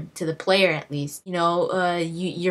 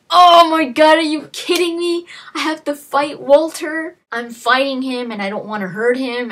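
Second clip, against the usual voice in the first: high, loud cry of disbelief, settling toward the end; incredulous outcry; 9 semitones higher, 8 dB louder than usual. Retold or imitated. Forced to fight Walter, crying out in disbelief. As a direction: shout it like you can't believe it. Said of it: "And you're just like".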